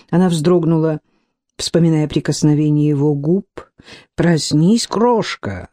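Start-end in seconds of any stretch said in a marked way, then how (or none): none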